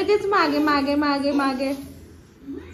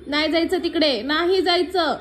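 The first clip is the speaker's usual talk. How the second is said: louder, higher firm telling-off; 5 semitones higher, 6 dB louder than usual. Real, not acted. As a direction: stop her in her tracks. Stopping toddler going out.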